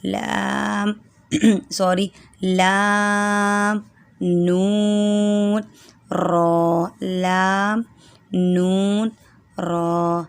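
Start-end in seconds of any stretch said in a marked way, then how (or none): 2.4-10.2 لام نون را drawn out slowly and louder; letter recitation. Shown as a demonstration. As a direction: stretch each letter name. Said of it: "مخرج ایک ہی ہے".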